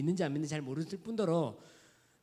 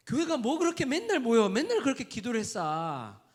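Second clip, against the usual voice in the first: high, loud scornful nagging; loud mock complaint of a critic; 7 semitones higher, 7 dB louder than usual. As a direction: sneer like a disapproving outsider. Mocking critics of the church.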